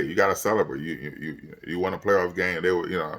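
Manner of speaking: usual speaking voice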